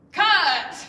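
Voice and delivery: loud, high shout to stop filming; sudden full-voiced on-set call; director's shout. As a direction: yell it like a film director halting the scene. Calls cut as film director.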